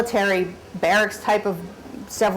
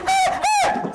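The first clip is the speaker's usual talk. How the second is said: very high shrieked ah; hammed-up high scream; mock scream. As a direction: shriek like a grown man terrified of a spider.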